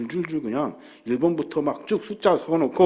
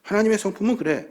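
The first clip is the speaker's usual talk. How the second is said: ordinary delivery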